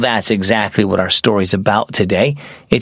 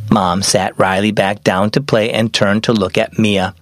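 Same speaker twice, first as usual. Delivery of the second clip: ordinary delivery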